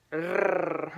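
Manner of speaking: long trilled growly purr; imitated rolling purr. Dustin showing off his teeth, imitated trilled purr. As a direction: roll the R like a purring show-off. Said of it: "when he says".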